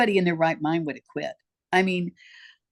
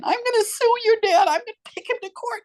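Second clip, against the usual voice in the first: re-enacted tearful outburst, pitched way up; high, wailing mock-crying voice; crying threat; 4 dB louder than usual. Mother tearfully threatening to sue the kids' dad, high crying voice. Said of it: "I'm crying and I'm saying".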